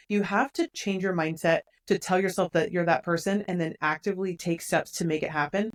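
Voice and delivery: ordinary talk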